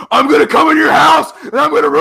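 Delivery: loud, high shouted threat; imitated screaming Marine. Mimicking the Marine yelling at a kid over Halo, screaming. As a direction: bellow it like an enraged Marine gamer. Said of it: "and he's screaming".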